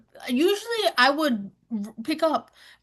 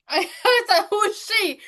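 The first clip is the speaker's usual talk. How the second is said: laughing; 8 semitones higher than usual. Real, not acted. Still laughing while retelling.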